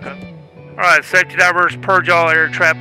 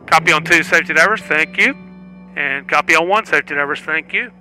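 tinny radio transmission; calling divers over a comms loop; helmet radio; 3 semitones higher, 3 dB quieter than usual. Real, not acted. Test director on comms.

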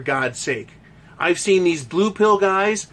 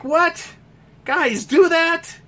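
high, slow mock shock; disbelieving single guy; 8 semitones higher, slower than usual. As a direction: act stunned, voice jumping up. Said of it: "Single guys are like".

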